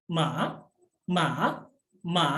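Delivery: MA dipping down, then rising back up; MA with a tick-shaped pitch, down then up; third tone MA. Shown as a demonstration. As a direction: drop MA low and lift its tail. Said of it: "Nada tiga itu".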